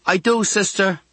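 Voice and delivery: put-on high girlish chatter; girl's voice. A narrator's reading. Girl to younger boy next door, girlish voice.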